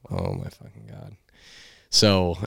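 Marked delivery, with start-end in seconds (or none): 0.1-1.2 low, soft, muttered curse; muttered under breath. Retold or imitated. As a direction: mutter it to yourself in dismay. Inner reaction to bad news.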